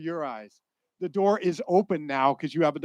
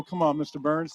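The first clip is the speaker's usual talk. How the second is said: ordinary delivery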